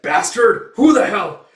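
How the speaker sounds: high, loud furious yell; angry shout. Acted. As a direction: roar at the unseen shooter. Thug shouts at attacker.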